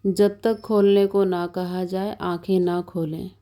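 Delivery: usual speaking voice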